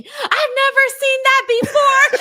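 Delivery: very high, loud mock scream; imitated fan screaming. Guy a few seats down at the show, imitated scream. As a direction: squeal it loudly like an amazed fan.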